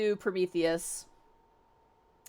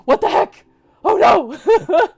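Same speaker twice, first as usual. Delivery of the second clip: high, loud cry of dismay; mock alarm; 9 semitones higher, 11 dB louder, slower than usual. Characters react to bad news, mock alarm. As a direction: exclaim in hammed-up shock. Acted panicked reaction in a retelling.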